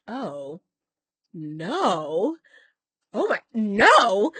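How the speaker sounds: imitating a doctor aghast, drawn out; mock-horrified, swooping drawn-out no; appalled reaction. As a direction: draw out each no, building to a loud one.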